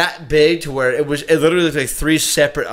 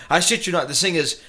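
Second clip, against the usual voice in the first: normal talking voice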